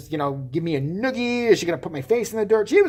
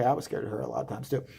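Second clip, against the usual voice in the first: ordinary talk, nothing marked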